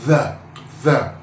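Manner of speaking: THE with a buzzing th, not D; tongue between the teeth on THE; voiced th. Shown as a demonstration. Teacher modelling T H, THE with voiced th. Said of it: "This T H is pronounced".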